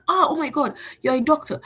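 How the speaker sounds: high, mock-amazed gasping praise; awestruck reaction. Stranger told someone is a doctor, amazed. Acted awe at meeting a doctor.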